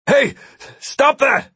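high-pitched yelled order. A narrator's reading. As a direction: yell at them to stop. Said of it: "Ampix yelled".

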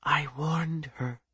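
slow, very soft murmur; hushed, drawn-out near whisper. A narrator's reading. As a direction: say it under your breath, slowly. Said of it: "whispered Ija".

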